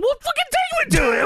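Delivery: very high, frantic impatience; exasperated mock shriek. Host voicing panicked wrestler to partner, high exasperated squeal. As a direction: squeal the demand in comic exasperation.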